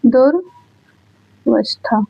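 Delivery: "दुर्वस्था" slowly, as dur, long gap, vas-thaa; split into parts. Shown as a demonstration. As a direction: say "दुर्वस्था" in two separate pieces, very slow. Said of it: "मिला दो तो क्या बना".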